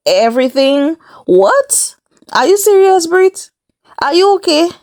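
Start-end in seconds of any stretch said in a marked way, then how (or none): 1.3-4.7 sudden high-pitched what, are you serious; genuinely floored, voice jumping up; shocked disbelief. Real, not acted. Vlogger reacting in disbelief to Brit's threat, shocked.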